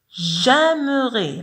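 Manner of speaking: J'aimerais drawn out, each vowel held for the class; teacher stretching out J'aimerais; slow French demo. Shown as a demonstration. Teacher models French word slowly for pupils. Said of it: "لاحظوا هنا آ إي".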